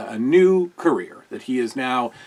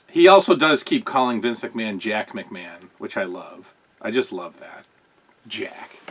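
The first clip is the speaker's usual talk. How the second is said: ordinary talk, nothing marked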